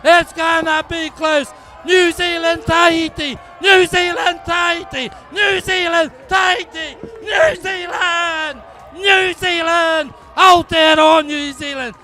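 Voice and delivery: loud, building live play-by-play; excited race call. Real, not acted. Commentator calling the canoe race to the crowd, building excitement. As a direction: call the finish as it happens.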